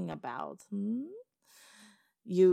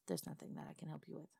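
dismissive murmur; 14 dB quieter, faster than usual. Acted. Brushing off the goblin.

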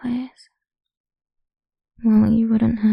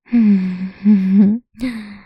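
dark laugh; 7 dB louder than usual. Acted. Dark laugh before command.

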